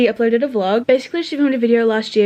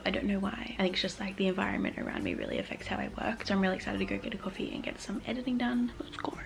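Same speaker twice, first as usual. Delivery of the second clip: hushed, breathy whisper to camera; 3 semitones lower, 14 dB quieter than usual. Real, not acted. Vlogger whispering her plans to viewers, a hushed whisper. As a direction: keep it a whisper so no one hears. Whispering vlog in quiet place.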